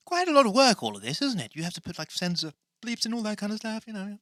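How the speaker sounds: high put-on comic voice, commenting; high character voice, mildly impressed; high-voiced sidekick. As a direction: chatter as the high-pitched alter ego.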